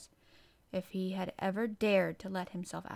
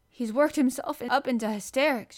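urgent, raised and louder; hurried; 4 semitones higher, 7 dB louder than usual. A narrator's reading. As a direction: blurt it out as a flustered nurse. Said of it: "she said in a great hurry".

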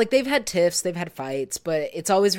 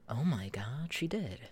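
whispered gasp of realization; shocked whisper; 4 semitones lower, 14 dB quieter than usual. Real, not acted. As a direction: breathe it as it dawns on you. Sudden realization mid sentence.

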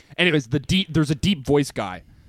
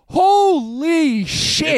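astonished gasp; 11 semitones higher, 4 dB louder, much slower than usual. Retold or imitated. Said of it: "I was like".

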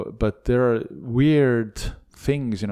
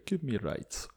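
put-on Transylvanian accent; 3 semitones higher, 8 dB quieter than usual. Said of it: "in my Transylvanian accent".

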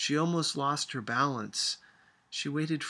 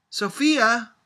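loud, high warning call of a name; 11 semitones higher, 13 dB louder than usual. A narrator's reading. Grandmother calling the lagging child back, loud warning. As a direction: call her back sharply. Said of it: "called grandmother warningly".

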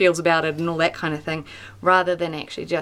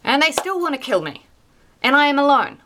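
Elijah's complaint; 6 semitones higher, faster than usual. Acted. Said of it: "Elijah pours out his complaint".

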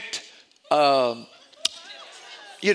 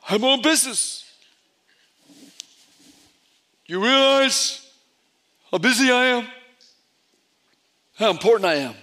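mock self-important; 3 semitones higher, 3 dB louder than usual. Mocking proud corporate attitude.

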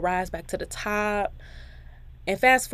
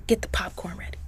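playful whisper; faster than usual. Pretend. Host whispering to listeners to get the popcorn ready, playful whisper. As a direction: lean in and whisper it like a secret.

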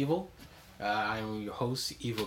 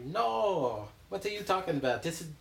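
put-on French accent; 8 semitones higher than usual. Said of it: "My French accent was just horrible".

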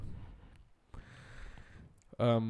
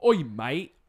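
loud, higher hammed-up Cockney accent; 7 semitones higher, 7 dB louder than usual. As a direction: play a brash London geezer in Cockney. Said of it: "That's why I can do me accent so well".

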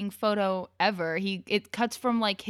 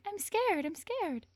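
high, soft mock-frightened squeal; pretend scared; 11 semitones higher, 6 dB quieter, slower than usual. Date clinging during a zombie movie, playfully scared. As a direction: squeak it small, playing scared to be held. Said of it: "hold on to them and be like".

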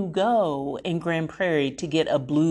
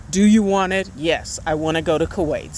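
ordinary delivery

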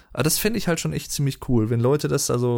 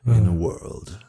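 movie trailer voice; much slower than usual. Shown as a demonstration. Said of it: "typische In a world".

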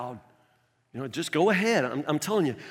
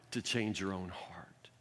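hushed whisper; 7 semitones lower, 13 dB quieter than usual. Real, not acted. Pastor whispering the point to the congregation, hushed. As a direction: drop to a whisper on the last words. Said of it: "drops to whisper for emphasis".

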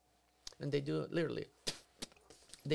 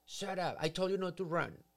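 louder, higher mock yell at the kid; angry yell; 4 semitones higher, 4 dB louder than usual. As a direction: snap at the child, acted out. Angry parent yelling at child.

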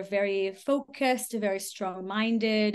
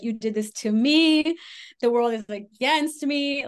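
higher and louder mock self-pity; whiny victim; 4 semitones higher, 6 dB louder than usual. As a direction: play the wronged martyr. Mimicking victim complaints.

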